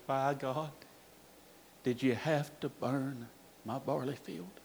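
hushed, defeated plea to God; quiet grief. Retold or imitated. Grieving father in his son's empty classroom, hushed.

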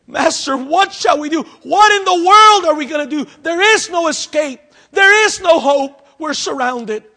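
a trapped man begging for an answer; panicked servant. Acted.

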